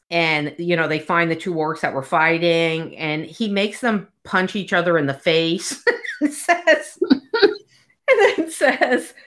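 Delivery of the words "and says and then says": high laugh breaking through; slow, dissolving in laughter; real giggles mid-sentence; laughing through the words. Real, not acted. Laughing while retelling.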